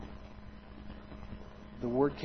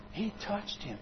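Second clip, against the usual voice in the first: awed crowd; 7 semitones higher, 6 dB quieter than usual. Retold or imitated. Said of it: "hear the crowd go".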